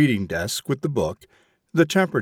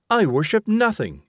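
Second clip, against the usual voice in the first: higher-pitched indignant outburst; angry cry; 8 semitones higher than usual. A narrator's reading. Mother rebuking her son, higher-pitched indignant cry. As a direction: fling it back at him, pitch jumping up in offence. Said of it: "she cried".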